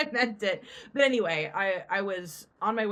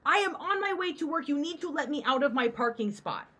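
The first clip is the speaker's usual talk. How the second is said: retold angry demand, pitched way up; 9 semitones higher, 5 dB louder than usual. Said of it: "And I'm like".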